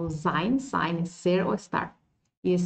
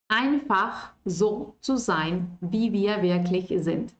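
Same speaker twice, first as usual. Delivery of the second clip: slow model line; slower than usual. Shown as a demonstration. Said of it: "Ya que estamos aquí en esta frase".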